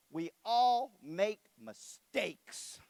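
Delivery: very slow, deliberate reproach; wife pointedly scolding husband through the son; pointed slow line. Retold or imitated. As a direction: drag each word out for effect.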